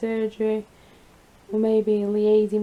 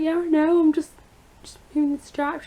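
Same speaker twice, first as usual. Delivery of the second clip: higher, louder, fed-up insisting; insist, irritated and raised; exasperated high protest; 6 semitones higher, 4 dB louder than usual. Retold or imitated. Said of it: "I was like".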